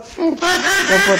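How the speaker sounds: high laughing command. Real, not acted. Ordering the flag off, laughing. (sambil tertawa)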